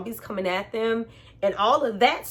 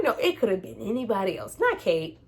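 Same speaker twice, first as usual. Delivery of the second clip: ordinary talk, nothing marked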